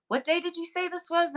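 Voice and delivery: high, curious mom voice; imitating mom. Quoting mom asking which day it happened, high and curious. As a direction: lift into mom's puzzled question. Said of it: "my mom goes".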